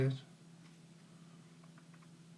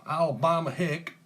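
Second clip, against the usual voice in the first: playful Southern US twang, higher and louder; mock Alabama accent; 4 semitones higher, 4 dB louder than usual. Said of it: "y'all talk like that".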